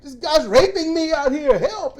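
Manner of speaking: unbothered joking call. Retold or imitated. Man calling for help on the roadside, jokey unbothered. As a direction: call for help like it's a joke. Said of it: "just the way he's sounding".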